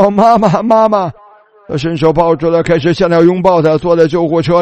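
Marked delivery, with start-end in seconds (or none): 0.0-1.1 higher-pitched imitation of a son calling his mother; retold overjoyed cry. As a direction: call out for mom, retelling the son's emotion. Son finds his mother alive again. (模仿儿子激动地高声喊妈妈)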